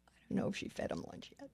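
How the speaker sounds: fast whisper, off to the side; hurried whispered remark off mic; whispered aside. Real, not acted. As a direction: murmur it quickly to someone nearby. Off-mic aside between calls.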